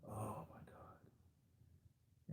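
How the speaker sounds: slow, breathless whispered "oh my God"; awed whisper. Real, not acted. Fan recalling Vision's death, slow stunned whisper. Reacting to Thanos killing Vision.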